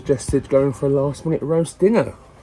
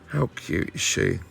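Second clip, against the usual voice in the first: ordinary talk, nothing marked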